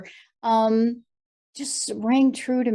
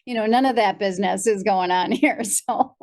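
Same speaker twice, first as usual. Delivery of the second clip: usual speaking voice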